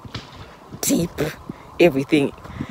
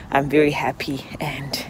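whispered aside; 5 dB quieter than usual. Real, not acted. Drops to whisper.

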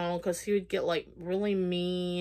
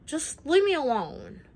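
higher, louder fed-up retort; exasperated outburst; 8 semitones higher, 3 dB louder than usual. Retold or imitated. Telling the ex to leave her alone, exasperated. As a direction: push him away, mildly exasperated.

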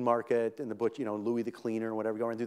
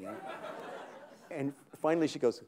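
ordinary talk, nothing marked

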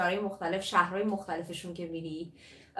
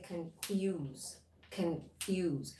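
"confuse" split in two, weak con, drawn-out FUSE; softer, lower "confuse", stressed from the f; stress on second syllable; 4 semitones lower, 9 dB quieter, much slower than usual. Shown as a demonstration. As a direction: model "confuse" slowly, leaning on "fuse". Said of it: "روی ف تاکید داره".

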